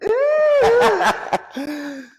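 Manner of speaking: real laugh, high and loud. Real, not acted. Embarrassed about telling her business.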